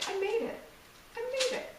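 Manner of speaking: high, sing-song brag; proud reply. Retold or imitated. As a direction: chirp it proudly, a little hammed-up. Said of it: "you just simply look and say".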